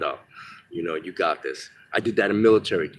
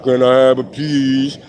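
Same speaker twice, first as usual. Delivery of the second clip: slow, loud cartoon character voice, pitched up; Fat Albert voice; 5 semitones higher, 8 dB louder, slower than usual. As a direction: drag it out loudly like a cartoon oddball. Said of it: "he talks like, if you ever watch Fat Albert".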